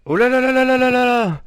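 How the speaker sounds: exasperated high lament; dismayed wail. Acted. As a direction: wail it on one long high note. Dismayed at his bewildering situation.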